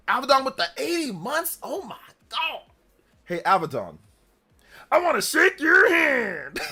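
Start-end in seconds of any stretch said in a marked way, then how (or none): 0.1-2.6 high-pitched awed exclamation; amazed excitement. Real, not acted. Amazed at eighty-month subscriber. 4.9-6.6 high, slow, loud catchphrase bellow; gritty shout. Acted. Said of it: "Don't say it in your normal voice".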